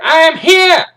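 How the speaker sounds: drill-sergeant bellow, high and loud; hammered parade-ground declaration; Major Payne. Acted. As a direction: shout it to the whole column. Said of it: "He's like".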